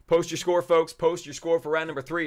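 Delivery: rally the folks at home; calling out. Real, not acted. Host calling viewers to post their scores, loud call.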